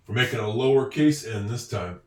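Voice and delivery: ordinary delivery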